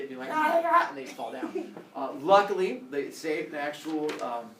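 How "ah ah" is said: higher, louder mock yelps of pain; mock pained cries. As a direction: yelp like you've just been zapped. Victims struck by lightning.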